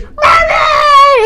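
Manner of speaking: put-on piercing high, loud kid whine; screaming child. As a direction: scream for mom like a demanding brat. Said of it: "It's like they're screaming".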